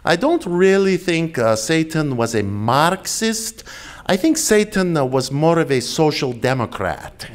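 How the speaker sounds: a fond Italian-accented impression of a historian. Lecturer imitating an Italian historian answering a question, in an Italian accent. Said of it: "in his wonderful Italian accent".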